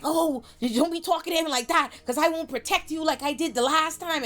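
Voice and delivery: a hint of a smug bodyguard; Rashid threatening. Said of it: "Rashid back there like".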